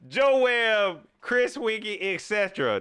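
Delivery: loud, high mock of an excited fan. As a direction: read the fan's list like a hyped-up fan. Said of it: "That's how they be sounding".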